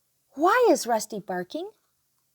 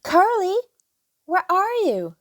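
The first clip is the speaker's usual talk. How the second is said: calling out; 8 semitones higher, 6 dB louder than usual. Acted. Children calling for pig.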